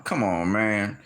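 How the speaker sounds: ordinary talk, nothing marked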